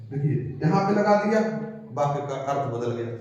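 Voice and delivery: ordinary talk, nothing marked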